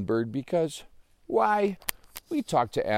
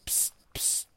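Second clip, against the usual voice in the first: soft whispered hiss; imitated psst; 7 dB quieter than usual. Joey repeats the bird's sound, whispering psst. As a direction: echo the secret hiss softly. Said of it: "did you just say".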